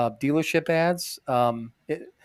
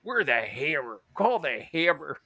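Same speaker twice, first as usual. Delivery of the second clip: lightly voiced cheesy ad lawyer; hammy lawyer ad; 2 semitones higher than usual. Lightly mocking the Hammer lawyers' TV ad. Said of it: "you're like".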